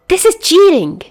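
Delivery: high, loud whine; pinned-down loser whining at the unfairness, high and loud. A narrator's reading. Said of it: "he whines".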